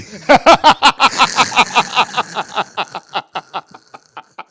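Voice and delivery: long loud high laughing fit; can't stop laughing; loud laughing fit after friend's joke. Real, not acted.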